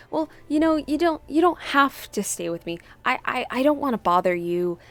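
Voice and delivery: gentle reassuring. Retold or imitated. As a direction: reassure them kindly, not wanting to be a bother. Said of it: "that's the kind of tone that Mina's taking".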